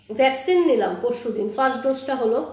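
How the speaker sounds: talking between bites; speaking while eating a meal; mouth full, chewing. Real, not acted. Seen 0: Spoonful eaten around 2s; she talks with food being chewed.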